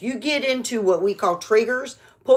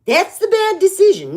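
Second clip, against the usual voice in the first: emphatic punch; 12 semitones higher, 8 dB louder than usual. Real, not acted.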